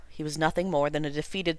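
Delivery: ordinary delivery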